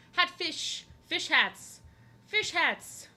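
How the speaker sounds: high, loud playful chant; gleeful naming. Real, not acted. Streamer gleefully naming her next fish drawings. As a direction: bounce the silly names out.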